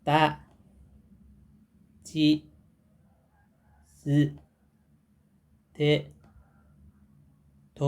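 ta chi tsu te to, each said apart; slow drill syllables. Shown as a demonstration. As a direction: read ตะ จิ สึ เท โท slowly with pauses. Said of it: "เรามาอ่านพร้อมกันก่อนนะ".

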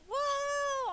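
high, loud drawn-out whoa; excited whoop. Acted. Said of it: "I'm normally like".

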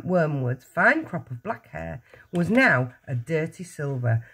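ordinary delivery, nothing marked

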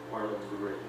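ordinary delivery, nothing marked

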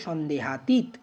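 সন্দেহাতীত slowly, in parts, ending on closed -teet; careful correct pronunciation. Shown as a demonstration. Teacher giving students the correct form, careful pronunciation. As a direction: spell out সন্দেহাতীত slowly with no final vowel. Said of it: "সঠিক উচ্চারণ হচ্ছে".

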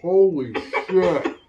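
slow, gasping through burning mouth; drawn out, reeling from the heat; mouth burning from spicy food. Real, not acted. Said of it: "My tongue is on fire".

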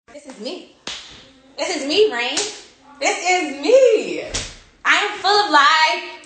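dramatic announcing. Pretend. Dramatic posing with baby.

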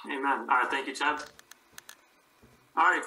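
usual speaking voice

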